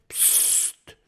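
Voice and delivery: sharp unvoiced shush; hushing psst. A narrator's reading. Professor hushes Kokosnuss, a sharp psst. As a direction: hush them urgently.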